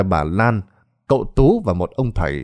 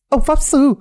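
much higher, urgent yell; desperate woman yelling commands; shrieking order; 3 dB louder, faster than usual. Acted. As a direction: scream for the sorcerer's help. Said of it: "Bà ta liền quát".

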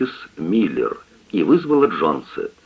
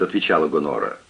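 ordinary delivery

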